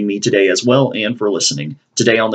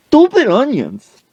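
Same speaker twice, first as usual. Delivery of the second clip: high, loud, drawn-out mock yell; angry holler; 5 dB louder, slower than usual. Coach heard from the bathroom, high exasperated yell. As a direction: cry out in hammed-up frustration. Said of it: "we just hear".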